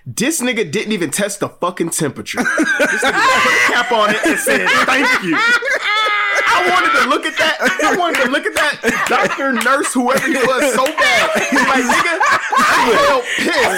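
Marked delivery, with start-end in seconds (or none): 2.4-13.8 high, loud, laughing outrage; outraged disbelief. Real, not acted.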